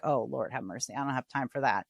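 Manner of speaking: low, soft weary grumble; exasperated. Retold or imitated. Host mimicking her own impatience with surveys, low weary grumble. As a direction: grumble it like you're fed up. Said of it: "I feel like".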